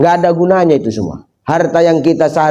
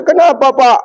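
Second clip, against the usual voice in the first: nosy neighbour; 8 semitones higher than usual. Acted. Neighbour asking.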